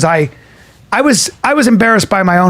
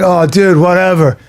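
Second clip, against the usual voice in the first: mock indifference. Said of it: "[name] acts completely inconvenienced".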